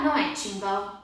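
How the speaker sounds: usual speaking voice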